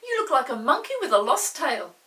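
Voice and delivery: put-on high, loud girlish teasing. Molly mocks Peter's cross face, loud teasing. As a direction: tease him like a cheeky sister.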